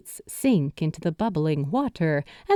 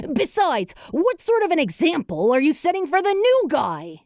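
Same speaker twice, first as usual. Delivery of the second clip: put-on indignant young spirit; 8 semitones higher, faster than usual. A narrator's reading. Character voice.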